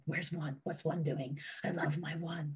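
the dog pining for its favourite human, hushed and soft; dog's voice. Retold or imitated. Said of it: "Everything is about one".

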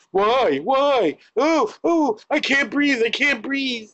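high, strained pleading, gasping for air; panicked arrestee. Retold or imitated. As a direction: plead like you're being pinned down.

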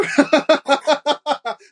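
sudden high laugh; high burst of laughter. Real, not acted. Amused by the study.

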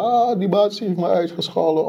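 high-pitched mock whine of an upset kid; hammed-up childish sob story; whiny crying kid. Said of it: "huilen of blaren".

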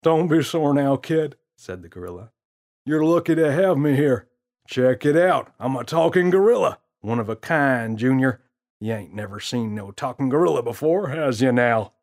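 put-on smooth-talking prankster teasing the kid; gorilla's voice. Gorilla boasts.

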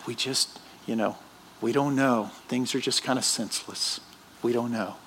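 mocking a TV priest's soft, lame answer; mumbled shrug. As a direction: trail off helplessly like a TV pastor. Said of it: "And you know what the guy will say?".

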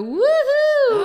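high, loud drawn-out woohoo; imitated cheering whoop. Table companions cheering the singer, high loud whoop. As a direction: whoop like an excited table of friends. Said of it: "alle de andre i bordet er bare".

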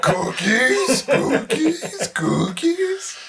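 high, rising sing-song; sing-song call. Acted. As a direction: call it out sweetly.

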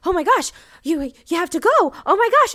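loud, high, building delight; excited. Retold or imitated. Retelling Mandy Patinkin urging the anchor to leave, gushing loudly. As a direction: gush with rising excitement. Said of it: "And he's like".

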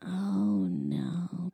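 hushed, drawn-out dread; dismayed whisper. Retold or imitated. Woody seeing what he's leaving, dismayed whisper.

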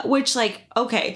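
usual speaking voice

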